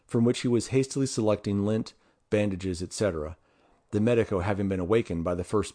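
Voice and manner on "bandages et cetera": usual speaking voice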